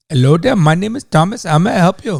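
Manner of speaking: Indian-accented call centre agent impression; mock Indian accent. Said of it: "with an Indian accent".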